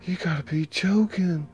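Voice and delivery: high, stunned disbelief; shocked. Acted. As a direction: gasp it out in disbelief. Josie hears of a murder.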